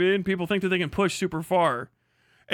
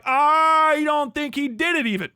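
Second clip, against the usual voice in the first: higher, louder, slow mock shout of a defender; mock shouted quote; 6 semitones higher, 4 dB louder than usual. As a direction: yell it as the heated internet crowd. People arguing the shooter's innocence.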